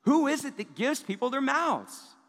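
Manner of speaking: high, loud exasperated rebuke, slowing at the end; a fed-up parent laying down the law; frustrated parent. Retold or imitated. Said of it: "a parent that would be frustrated with their child".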